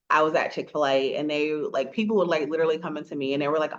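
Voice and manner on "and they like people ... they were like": ordinary talk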